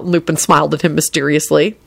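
usual speaking voice

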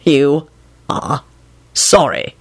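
halting, understated menace; ominous. A narrator's reading. Boss echoing a flippant apology, mildly ominous. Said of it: "Kingsley replied ominously".

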